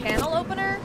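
ordinary talk, nothing marked

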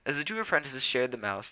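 ordinary delivery, nothing marked

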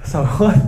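"Sword" in two syllables, suh-WOOT; wrong pronunciation of sword. Shown as a demonstration. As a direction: sound the w in Sword, rising. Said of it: "thì bạn cứ phát âm là sword".

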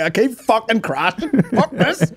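high laughing mock rage at the game; mock frustrated gamer. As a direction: fake fury at crashing, laughing. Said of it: "It's like".

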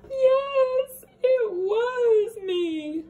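high, loud, slow crying; tearful confession. Acted. David confesses to Mom, crying high and slow. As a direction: confess through tears like a kid.

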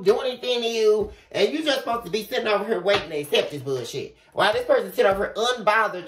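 normal talking voice

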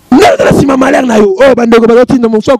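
normal talking voice

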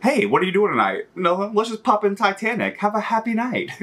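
a hint of a chirpy pal planning a sad movie night; playful imitation of a weepy-movie fan; mock friend chat. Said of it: "those people that just watch The Notebook".

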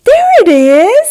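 very high, loud eager cry; excited bird. Acted. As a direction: call out a discovery. Bird spots something.

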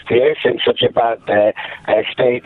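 normal talking voice

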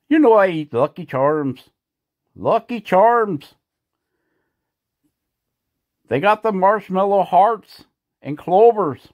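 a light leprechaun put-on. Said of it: "Mister Leprechaun".

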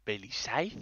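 quiet baffled repeat of "Belisaiz" as a question; puzzled echo. Retold or imitated. She hears her misspelled name called and echoes it, baffled and quiet. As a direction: softly repeat "¿Belisaiz?" rising, in disbelief. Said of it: "Y yo".

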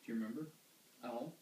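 usual speaking voice

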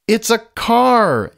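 high storybook yell; skunk yells. A narrator's reading. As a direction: shout it out as the skunk. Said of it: "yells the skunk".

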